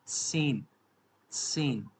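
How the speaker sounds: सेन opening on a sharp ts with long e; German zehn. Shown as a demonstration. Teacher showing learners ten, सेन with sharp ts. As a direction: say सेन as tsen. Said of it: "इसको हम बोलते हैं".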